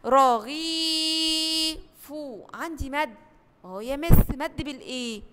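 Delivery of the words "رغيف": رغيف with the ee held long and slow; drawn-out long vowel. Shown as a demonstration. Teacher drawing out the vowel for first graders, slow. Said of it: "كلمة".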